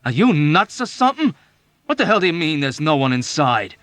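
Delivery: high loud alarmed questions; frightened shout. A narrator's reading. Yossarian shouting at Dunbar in shock. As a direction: shout it, stunned and scared.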